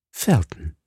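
low, solemn address with falling tune; solemn, melancholy. A narrator's reading. Milady begins her tale to Felton, solemnly melancholy. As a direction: say the name with grave sadness. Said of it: "in einem feierlich melancholischen Ton".

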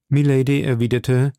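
ordinary delivery, nothing marked

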